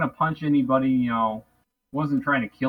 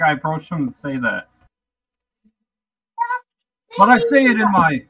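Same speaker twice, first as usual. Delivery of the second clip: ordinary delivery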